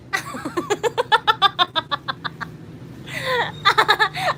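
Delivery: high-pitched laughing fit; helpless high laughter. Real, not acted. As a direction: crack up, can't stop laughing. Cracking up at King Kong joke.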